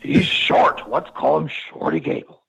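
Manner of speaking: loud mock boss pitching an idea; hammed-up executive impression; Vince McMahon. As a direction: put on a blustery boss voice. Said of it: "that's Vince McMahon right there".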